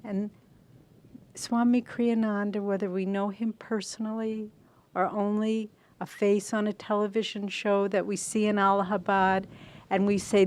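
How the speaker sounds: normal talking voice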